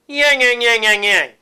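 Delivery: high, loud, forward witch yang; nasty witch sound placed in the head; cackling witch, focused bright and forward; witchy voice. Shown as a demonstration. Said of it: "try that".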